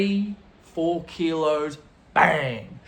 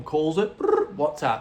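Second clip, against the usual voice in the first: ordinary talk, nothing marked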